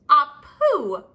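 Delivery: explosive loud sneezed ah pooh; a giant fish sneezing; big sneeze. Acted. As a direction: blast it out like a huge sneeze.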